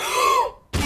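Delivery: sudden high shocked gasp; sharp gasp. Real, not acted.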